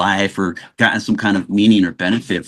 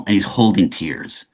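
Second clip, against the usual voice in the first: ordinary talk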